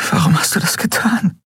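high, pained question; grieving plea. A narrator's reading. Endriel asks the still Miko why, in grief. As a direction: beg the dead boy for a reason.

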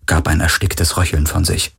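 ordinary delivery

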